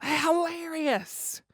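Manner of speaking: high, very slow, drawn-out delight; laugh, then stretch the word out high; amused. Real, not acted. Comedian tickled by his own retort, amused.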